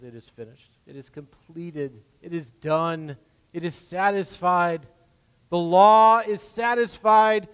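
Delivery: starts hushed, swells to a loud, slow climax on law; building proclamation. Acted. Preacher giving Christ's words from the cross, rising from hushed to loud. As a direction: build each phrase until it rings out.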